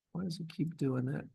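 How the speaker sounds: puzzled, under-the-breath grumble at the software; muttered aside. Real, not acted. Presenter asks themself why the software misbehaves, muttered aside.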